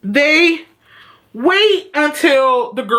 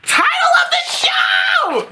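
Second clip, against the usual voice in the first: giddy high-pitched cheer; thrilled exclamation. Real, not acted. Thrilled cry at the title drop.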